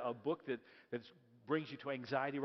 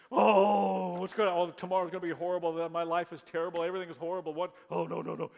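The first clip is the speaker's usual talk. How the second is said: hammed-up despair, loud; dramatic sigh; 2 semitones higher, 9 dB louder than usual. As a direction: moan it out in panic. Joseph voiced in despair.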